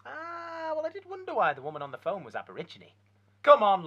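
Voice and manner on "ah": ordinary talk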